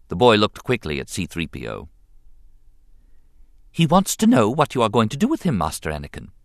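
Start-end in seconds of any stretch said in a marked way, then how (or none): 3.7-6.3 lightly higher fussy droid; prim robot translator; protocol robot. A narrator's reading. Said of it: "the droid translated".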